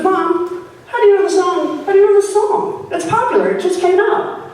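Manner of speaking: high, disbelieving tween; incredulous tween. Retold or imitated. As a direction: scoff like a twelve-year-old.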